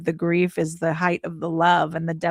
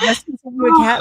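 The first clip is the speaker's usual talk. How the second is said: high, louder disbelieving question; incredulous husband; 5 semitones higher, 7 dB louder than usual. Retold or imitated. Husband hearing the news on the phone, high incredulous question. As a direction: play the stunned husband on the phone. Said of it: "He's like".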